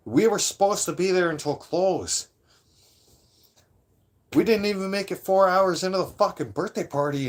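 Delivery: host venting at the friend who ruined his party, loud; heated rant. Real, not acted. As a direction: let the frustration build and spill out.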